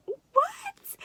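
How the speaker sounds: high-pitched, loud mock "what?!"; retold shock. New mother retelling hearing she can push, shocked. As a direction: pitch leaps way up in exaggerated disbelief. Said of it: "I was so in shock".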